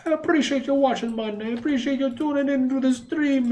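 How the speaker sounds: put-on a sudden playful Jamaican accent, pitched high; thanking the stream in a Jamaican accent. Streamer thanks viewers in a mock Jamaican accent. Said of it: "I don't know why I went Jamaican there".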